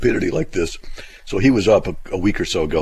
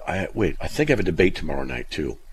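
ordinary delivery

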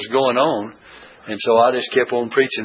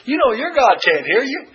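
higher, sing-song jeer; mocking taunt; 11 semitones higher than usual. Elijah jeering at the prophets of Baal, mocking taunt. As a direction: taunt them like a schoolyard tease. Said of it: "coming to a little sense of humor".

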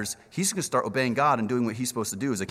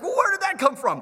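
much higher, louder mock surprise; 3 dB louder than usual. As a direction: act baffled at an answered prayer. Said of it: "you're like".

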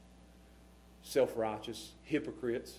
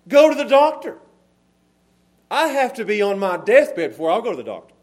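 loud, raised preacher's voice; high, forceful sermon delivery; fervent preaching; 7 semitones higher, 11 dB louder than usual. Real, not acted. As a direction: drive it home from the pulpit.

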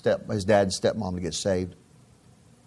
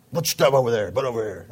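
gruff trash lady. Retold or imitated. Trash lady barking orders.

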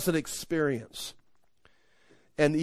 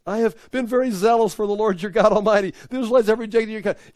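high, loud, rushed recitation; mock whining complaint; 6 semitones higher, 6 dB louder, faster than usual. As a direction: rattle off the same grievance indignantly. Mocks Elijah repeating his complaint.

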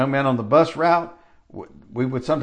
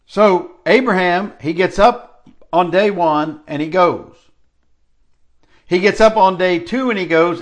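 louder, high, rolling preacher cadence; preaching at full force; 4 semitones higher, 5 dB louder than usual. Acted. Preacher tells the congregation of Abraham, building fervour. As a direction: drive the story home to the congregation.

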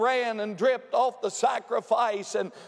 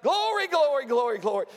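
high, excited burst of repeated praise; imitating shouting churchwoman; 6 semitones higher, faster than usual. Preacher showing how Sister shouted in church, high praise. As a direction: mimic a woman happily shouting praise. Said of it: "and she was like".

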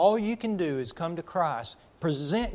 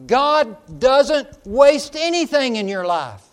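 loud, slow, high proclamation with weight on waste; emphatic declaration; 7 semitones higher, 10 dB louder, slower than usual. Acted. Preacher assuring the congregation that God wastes nothing, booming. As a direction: proclaim it with full conviction. Climax of sermon point.

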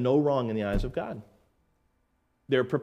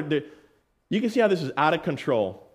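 usual speaking voice

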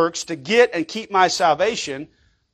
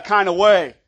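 ordinary delivery

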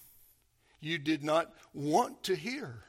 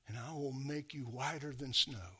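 soft, low, hushed promise; tender promise; 3 semitones lower, 7 dB quieter than usual. Acted. Preacher voicing Jesus' gentle promise of cleansing, softly. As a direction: let it fall gently. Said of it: "all they've ever heard from him is".

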